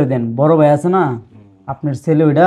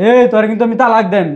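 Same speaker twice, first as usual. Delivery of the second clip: raised, higher, harsh demand; son-in-law angrily threatening to divorce his wife; angry threat; 7 semitones higher, 3 dB louder than usual. Acted.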